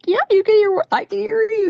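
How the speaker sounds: put-on high excited kid voice answering the cat; excited little girl. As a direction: replay her child self thrilled to hear the cat. Retold childhood talk with cat.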